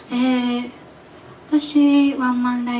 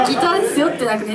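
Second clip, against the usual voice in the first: laughing retelling; 3 semitones higher, 4 dB louder than usual. Retold or imitated. Repeats the realization, laughing.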